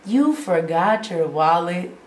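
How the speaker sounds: slow, drawn-out incredulous question; stunned question, replayed slowly; retold disbelief. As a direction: let the mock disbelief sink in. Acting out her disbelieving reply to dad.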